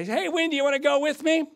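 high-pitched, bright call to his wife. Retold or imitated. Husband invites his wife along, calling out high.

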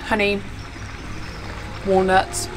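ordinary talk, nothing marked

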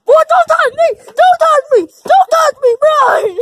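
high, loud screaming; loud yell. Pretend. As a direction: shriek it out. Kids taunting, mock screaming.

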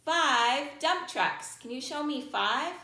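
ordinary talk, nothing marked

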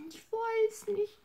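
high, slow helpless bunny voice; sad little bunny. Acted. Bunny Hops answers grandma, high slow helpless voice. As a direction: sound small and lost.

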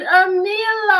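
long drawn-out happy call of a name. Real, not acted. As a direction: stretch the name out loud and slow, calling across a room. Greeting viewer by name, calling out.

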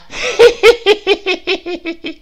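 high, loud triumphant cackle; witch cackle. Acted. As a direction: laugh like a witch. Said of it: "cackling and spitting".

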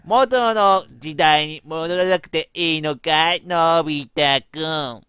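slow, sweet, creepy Doraemon coaxing; sweet eerily obsessive voice. Acted. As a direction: lure him back slowly, sweetly. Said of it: "やべえドラえもんがいる".

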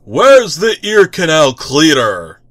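loud, high call from another room. Acted. The tick hollering for Arthur from the bathroom. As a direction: shout across the flat.

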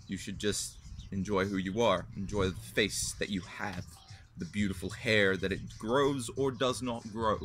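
ordinary talk, nothing marked

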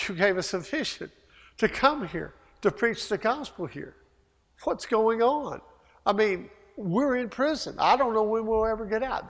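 hypothetical whine of bewildered prisoners; mock complaint. Imagined complaint of jailed Paul, Silas.

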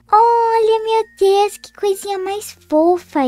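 high, excited gasp of delight; delighted gush. Acted. Girl first sees her friend's baby sister, delighted gush. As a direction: melt over the cute baby.

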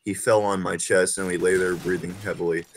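usual speaking voice

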